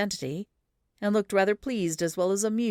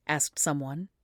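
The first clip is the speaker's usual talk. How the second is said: ordinary delivery, nothing marked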